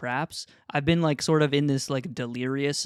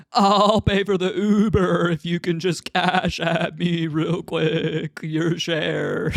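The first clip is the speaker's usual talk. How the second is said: slow, louder goofy sheep asking for money; drawn-out cartoon sheep asking a friend to pay; Greg voice; 3 semitones higher, 5 dB louder, slower than usual. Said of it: "and Greg goes".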